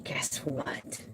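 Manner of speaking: ordinary talk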